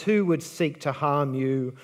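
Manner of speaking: normal talking voice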